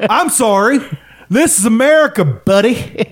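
high, drawn-out mock outrage; mock affronted.

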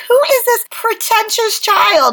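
annoyed. Acted. Host imagining overhearing a pretentious child on the street, acted annoyance. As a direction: draw it out, mildly appalled. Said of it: "I would get so annoyed".

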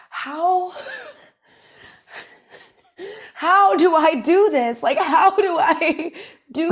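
laughing, loud, high mock despair. Woman asking how to juggle kids and Broadway, laughing mock despair. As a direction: play up the overwhelm, laughing through it. Acting out own overwhelm about kids.

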